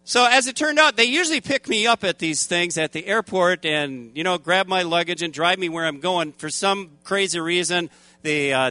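usual speaking voice

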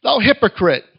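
measured, emphatic King James reading; Scripture reading. Acted. Preacher reads Matthew seven to the congregation, proclaiming. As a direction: proclaim the verses with weight.

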